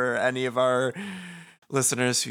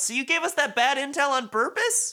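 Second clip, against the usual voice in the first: put-on much higher, indignant young voice; Fret's voice; 14 semitones higher than usual. Fret confronts Motoi about the bad intel, high indignant voice. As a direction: squeak out the accusation. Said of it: "Fret says".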